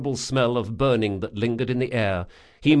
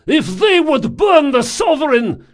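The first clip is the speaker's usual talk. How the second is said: high, loud battle shout; 9 dB louder than usual. A narrator's reading. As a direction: roar orders over the fire. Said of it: "shouted Rodanov".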